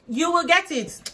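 "You will get it" cried out high, loud and slow with weight on "get"; loud, preaching. Shown as a demonstration. Promising viewers a good marriage, loud preaching. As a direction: belt "get" like a pastor's promise.

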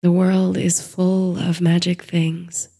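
usual speaking voice

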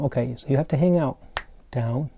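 normal talking voice

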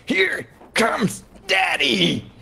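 high, slow villain holler; drawn-out mock shout. Playing a villain coming after his prey, high drawn-out shout. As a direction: holler it out, stretching every word.

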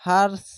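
"হার্স" cut to one syllable ending in s; stopped final consonant. Shown as a demonstration. Teacher shows learners the pause form, "হার্স" ending on bare s. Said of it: "এরকম".

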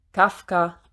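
plain reading voice

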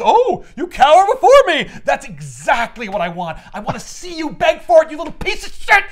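hammed-up gloating demon, high and loud; domineering villain rant; Mephisto. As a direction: relish the victim's terror, building to a shout. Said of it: "Mephisto shows up and he's like".

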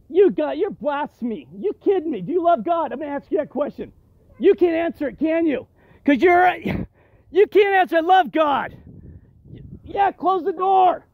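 high, loud, hectoring challenge; heated confrontation. Real, not acted. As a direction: push her hard, voice climbing with outrage. Confronting woman at temple door.

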